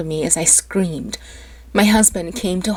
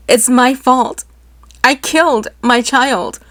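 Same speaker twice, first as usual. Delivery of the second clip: 1 mild grief; 5 semitones higher, 5 dB louder than usual. A narrator's reading. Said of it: "I whispered through sobs".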